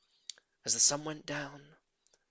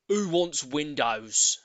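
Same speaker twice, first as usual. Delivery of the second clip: slow, drawn-out scornful question; grumpy Mister Twit; 7 dB louder, much slower than usual. Acted. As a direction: sneer it slowly like a grouch. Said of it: "Mister Twit had said".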